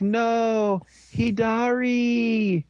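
high, drawn-out dramatic wail of dismay; mock anguished cry. Viewer reacting to the hero falling, mock anguished cry. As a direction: cry out in hammed-up despair, stretching the name.

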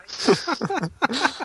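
a high burst of laughter. Real, not acted. Laughs after own joke.

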